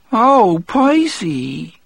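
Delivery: high, sing-out mock dismay; mock scolding. A narrator's reading. Broodle mocking Percy over the dirty book, mock scolding. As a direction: tease him in a high, scandalized voice.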